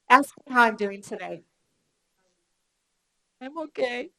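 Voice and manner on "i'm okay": softer, higher, deflated okay; unconvincing okay. Shown as a demonstration. Trainer demos how tone of voice betrays feeling, sounding down. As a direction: say it so nobody believes you. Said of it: "You see the difference, right?".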